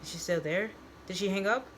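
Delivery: usual speaking voice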